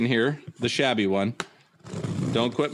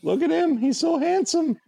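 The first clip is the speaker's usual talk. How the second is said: very high cooing voice; mock gushing. Showing off the new figure, high mock-adoring voice. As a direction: coo over it, pitched way up.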